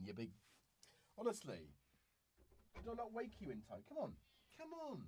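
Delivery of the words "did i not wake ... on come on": high, fast pet-talk; coaxing the cat. Real, not acted. Owner coaxing her cat onto camera, high pet-talk. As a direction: coax him over fondly.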